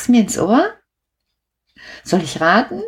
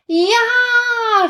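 put-on children's cheer. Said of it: "riefen die Kinder".

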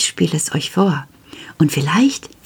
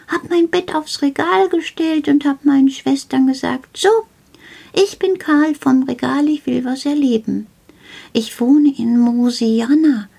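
lightly voiced little gnome, a touch higher, chatty and proud; Karl the shelf gnome; 8 semitones higher than usual. Little gnome tells the child about his home Mosiana, lightly voiced and proud. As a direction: play a small cheeky gnome boasting about his faraway home.

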